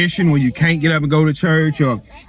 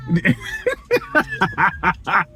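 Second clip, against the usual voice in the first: laughing; 9 semitones higher, 3 dB quieter than usual. Real, not acted. Laughs after the mute joke.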